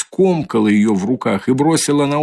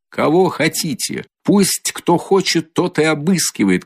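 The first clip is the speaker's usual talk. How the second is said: shouting; 6 semitones higher than usual. A narrator's reading. Katerina shouting at Luzhin to let anyone search. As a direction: fling the dare at them. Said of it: "кричала Катерина Ивановна".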